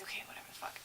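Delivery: very soft, whispered brush-off; muttered dismissal. Retold or imitated. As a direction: mutter it under your breath. Retelling a dismissive aside to customers.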